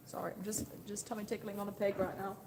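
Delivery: ordinary talk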